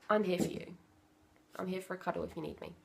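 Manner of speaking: soft, quick reassurance; reassuring. Shown as a demonstration. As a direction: comfort the child gently. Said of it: "That's what I do".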